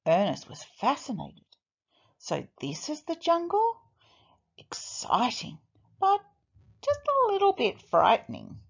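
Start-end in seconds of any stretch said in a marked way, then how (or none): 2.2-8.6 excited but nervous. A narrator's reading. Ernest thinking, excited yet scared.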